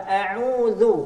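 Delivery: a'udzu said slowly, open A; slow thin a'udzu. Shown as a demonstration. Teacher models a'udzu again slowly. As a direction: draw out a'udzu with a thin hamzah.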